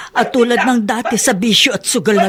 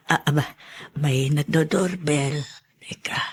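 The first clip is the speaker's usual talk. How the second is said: whisper aside; 7 semitones lower, 9 dB quieter than usual. Acted. Maid whispering to herself.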